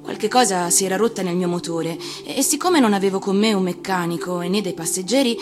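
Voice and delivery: ordinary delivery, nothing marked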